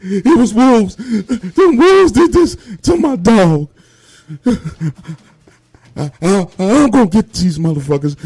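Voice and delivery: high, loud acted wail; crying and sobbing. Grieving man tells friends what killed his dog, sobbing. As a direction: sob through the words, catching on breaths. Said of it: "Still crying and sobbing tears".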